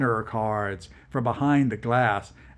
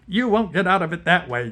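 higher, fast angry snap; angry yap; 7 semitones higher, 5 dB louder, faster than usual. Acted. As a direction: snap it back at him quickly. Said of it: "Yezhov yapped, angrily".

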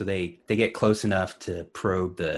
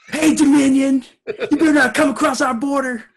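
border patrol yell; 9 dB louder than usual. Acted. Shouting at Dominion ships.

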